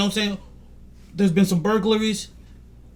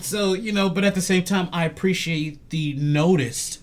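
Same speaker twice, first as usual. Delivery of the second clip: ordinary delivery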